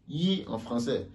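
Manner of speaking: ordinary delivery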